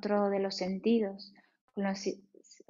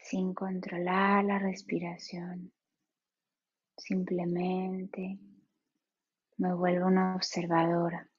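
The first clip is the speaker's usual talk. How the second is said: guided meditation. Acted. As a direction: ease them into stillness, unhurried.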